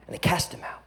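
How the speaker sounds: "And they cast him out" breathed as an unvoiced whisper; a hushed, solemn "And they cast him out". Shown as a demonstration. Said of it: "first said plainly".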